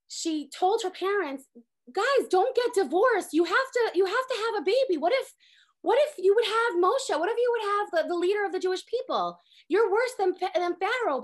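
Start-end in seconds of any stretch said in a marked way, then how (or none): 1.9-11.2 louder, higher, insistent scolding; urgent plea. Retold or imitated. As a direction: retell a child urgently lecturing her parents. Young girl lecturing her parents.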